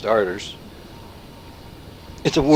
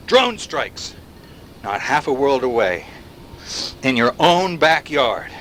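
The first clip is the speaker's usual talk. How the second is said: ordinary delivery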